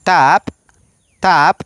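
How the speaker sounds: «tap» with an ordinary T; «tap» said slowly and clearly; plain T in Maya. Shown as a demonstration. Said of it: "Por ejemplo". («tap» con t normal, despacio)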